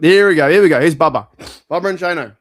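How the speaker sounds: hyped to play the video; excited. Real, not acted. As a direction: announce the clip with glee. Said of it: "I wanna see Bubba freak out".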